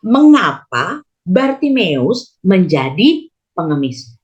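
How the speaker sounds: usual speaking voice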